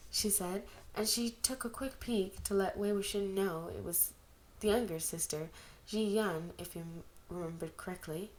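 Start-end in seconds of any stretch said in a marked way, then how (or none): none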